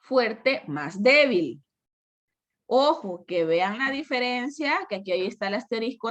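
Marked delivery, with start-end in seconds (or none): 0.0-1.6 slow stressed formula. Shown as a demonstration. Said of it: "Fórmula tres".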